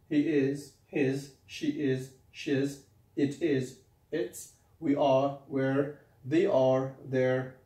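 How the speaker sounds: slow teaching demo. Shown as a demonstration. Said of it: "teacher models pronouns slowly".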